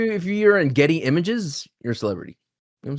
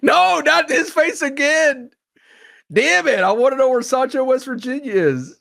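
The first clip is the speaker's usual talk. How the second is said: hammed-up annoyed searcher, pitched way up; high annoyed mock voice; 11 semitones higher than usual.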